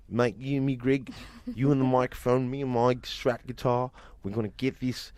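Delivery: Sammy's broad Australian accent, lightly put on; imitating an Australian bandmate's Australian accent; Aussie mate voice. Bandmate hyping Greg up in an Australian accent. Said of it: "He said".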